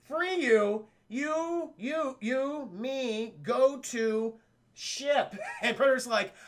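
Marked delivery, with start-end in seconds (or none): 0.0-5.3 slow, high, word-by-word mock explanation; miming to a foreigner. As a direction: spell it out as if they barely understand. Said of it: "he's like miming".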